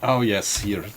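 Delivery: normal talking voice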